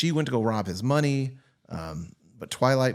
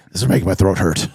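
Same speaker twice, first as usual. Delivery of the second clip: gravelly Batman voice; 5 semitones lower, 8 dB louder, faster than usual. Retold or imitated. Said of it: "he was like".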